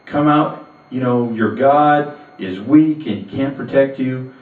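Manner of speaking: bellowing taunt from across the valley; taunting giant. Acted. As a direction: jeer at them like a giant calling cowards out. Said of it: "hears him going".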